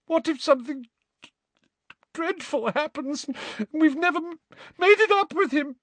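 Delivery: high, stammering, loud crying outburst; Molly sobbing about the Order families; distraught crying. A narrator's reading. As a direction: pour out every fear while sobbing.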